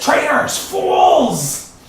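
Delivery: high, loud roaring monster curse; furious shout. Acted. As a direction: bellow it like an enraged eyeball. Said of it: "and the eyeball says".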